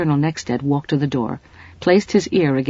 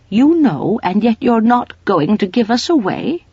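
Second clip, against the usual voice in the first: high, louder astonished question; 7 semitones higher, 5 dB louder than usual. Acted. Mrs Polifax to the colonel, astonished and higher. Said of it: "staring at him in astonishment".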